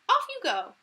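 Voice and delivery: high, loud sending her off; cheerful send-off. Acted. Fairy godmother sending Cinderella off, high cheerful. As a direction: shoo her off gaily. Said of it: "the fairy godmother exclaimed".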